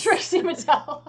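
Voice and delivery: loud, high, through laughter; helpless amused outburst; laughing. Real, not acted. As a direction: crack up while repeating the name. Cracks up repeating a joke.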